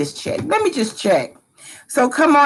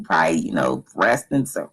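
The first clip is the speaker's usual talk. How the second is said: much lower snoring voice; fake snoring voice. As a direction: sound like a sleeper snoring. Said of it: "fake snoring voice".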